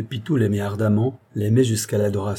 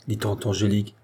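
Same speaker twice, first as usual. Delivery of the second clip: ordinary delivery, nothing marked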